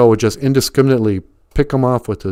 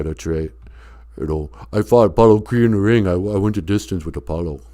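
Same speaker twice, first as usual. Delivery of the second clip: Stallone voice; 5 semitones lower, 5 dB quieter than usual. Acted. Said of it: "sounded exactly like Sylvester Stallone".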